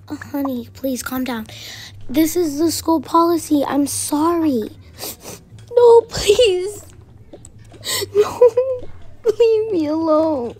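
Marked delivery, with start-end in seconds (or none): 0.1-1.5 soothing hush. Acted. Teacher soothes upset girl. 5.7-10.6 frightened pleading. Acted. Girl refuses to leave with stranger.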